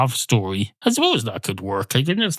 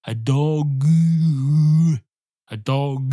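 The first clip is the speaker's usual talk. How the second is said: dog savoured, with a different timbre; enjoy the word dog; relished punchline; 4 semitones higher, slower than usual. Shown as a demonstration. Storyteller showing why he enjoys the opener. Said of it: "I enjoy saying that".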